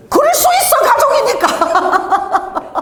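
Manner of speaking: very high, stretched comic holler; mock shouted retort. Preacher acts the expected family answer for the congregation, mock shouted retort. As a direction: belt it out high, breaking into laughter.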